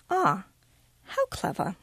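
put-on faint praise, lifted high in English accent; barbed English accent. A narrator's reading. English teacher answering Miranda's Braille idea, barbed English accent. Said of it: "uses her accent to barb everything she says".

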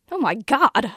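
loud, horrified exclamation; a sudden outburst of horror; horrified blurt. A narrator's reading. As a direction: blurt it out, aghast. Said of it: "I blurt out in horror".

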